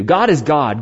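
usual speaking voice

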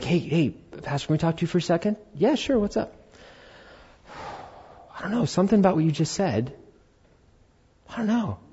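quiet, hesitant voices; softly, as if confiding something; hushed conversation. Retold or imitated. Teenagers quietly approaching the pastor.

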